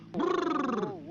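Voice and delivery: teeth-chattering cold brrr; shivering brrr. Acted. Says he's cold.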